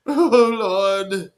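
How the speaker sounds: loud hammed-up wail; mock crying. Mocking the crying skater, loud fake wail. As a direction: cry out like a fake mourner.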